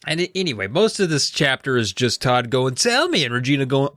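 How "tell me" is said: high, insistent demand; mock pestering. Acted back-and-forth of sibling pestering.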